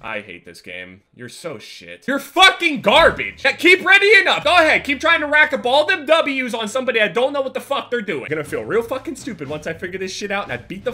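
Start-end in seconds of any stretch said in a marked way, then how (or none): 2.1-6.6 loud, high-pitched trash talk; angry taunt. Real, not acted. Gamer berating the opponent who readies up, angry taunt. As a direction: yell insults at the opponent.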